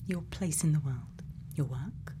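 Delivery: ordinary talk, nothing marked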